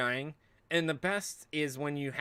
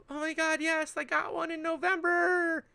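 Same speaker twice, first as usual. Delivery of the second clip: mock excitement. Streamer mocks buyer who got a card, high giddy squeal. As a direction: squeal like a thrilled gamer.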